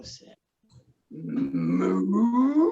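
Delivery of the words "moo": low mooing cow; a low lazy moo. Acted. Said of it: "said the cow".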